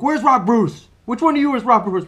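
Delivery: imitating an angry man, loud and high; mad. Angry husband bursts into the store hunting for Rob, mad. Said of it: "He's mad".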